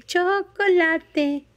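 chocolate said very slowly, syllable by syllable; slow model word. Shown as a demonstration. Teaching the dog a word, very slowly. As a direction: stretch "Chocolate" slowly so the dog can copy it.

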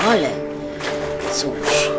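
normal talking voice

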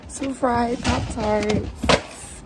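very slow, loud, high showy reveal; drawn-out announcement. Real, not acted. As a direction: stretch it out like a big reveal. Announcing the dish to viewers.

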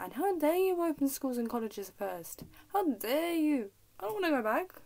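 loud, high-pitched indignation; indignant outburst. Real, not acted. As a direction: scold loudly, pitch swooping up. Mock scolding of Boris Johnson.

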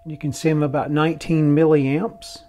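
ordinary delivery

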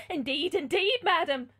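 hammed-up near-tears pleading; half weeping in agony. A narrator's reading. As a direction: plead as the frightened servant. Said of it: "half weeping with agony".

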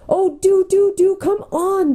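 high, drawn-out urgent plea; begging. A narrator's reading. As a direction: beg them to hurry, voice right up high. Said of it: "begged Jill".

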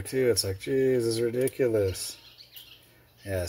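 ordinary talk

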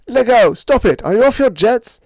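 very high, loud protest; screamed. A narrator's reading. Sparks screams at the skipper to stop smashing the set. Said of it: "I screamed".

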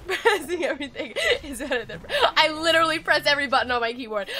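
talking through giggles; words breaking into helpless laughter; laughing. Real, not acted. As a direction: crack up while confessing. Cracking up over frantic button pressing.

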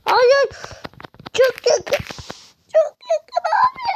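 high, loud frantic protest; desperate shout. Real, not acted. Gamer yelling at a near miss, high frantic shout. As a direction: yell it as the match slips away. Losing a close game round.

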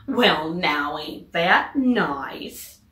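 slow, drawn-out folksy man; street sweeper. Acted. The street sweeper admiring the ducks, slow folksy voice. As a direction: savour it slowly, pleased. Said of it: "the man who swept the street said".

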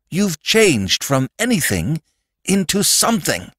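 impassioned listing, rising at the end; urgent Sandman. Acted. Sandman explaining accepted labels to the boy, urgently insistent. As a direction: press each point home.

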